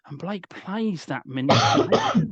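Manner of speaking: ordinary talk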